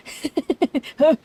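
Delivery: high amused laugh; bright startled giggle; high burst of laughter. Acted. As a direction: laugh out at the weirdo. Reacts to fourth-wall joke.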